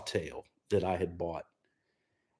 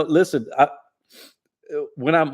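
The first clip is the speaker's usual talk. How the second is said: normal talking voice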